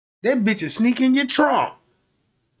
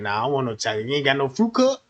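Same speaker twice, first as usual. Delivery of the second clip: put-on much lower kid voice haggling; low gruff haggler; 8 semitones lower, 3 dB quieter, much faster than usual. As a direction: growl out the counteroffer low. Kid refusing chocolate milk.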